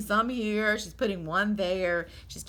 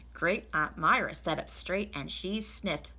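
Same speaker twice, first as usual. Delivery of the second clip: usual speaking voice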